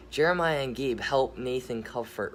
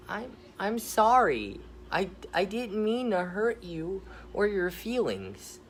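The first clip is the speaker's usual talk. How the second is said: teary apology; 8 semitones higher than usual. Acted. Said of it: "with tears in his eyes".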